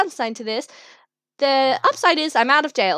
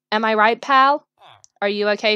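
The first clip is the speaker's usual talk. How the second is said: normal talking voice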